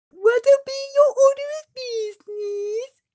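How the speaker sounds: high, loud receptionist voice; orc secretary. Acted. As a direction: greet them brightly as the orc clerk.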